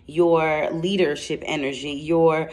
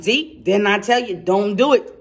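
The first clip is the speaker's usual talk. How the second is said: normal talking voice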